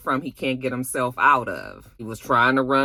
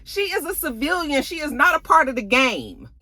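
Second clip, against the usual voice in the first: put-on boyfriend scolding her, high-pitched; high mock boyfriend. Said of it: "And he was just like".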